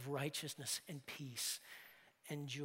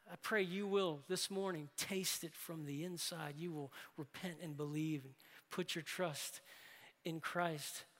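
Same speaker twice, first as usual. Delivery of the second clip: usual speaking voice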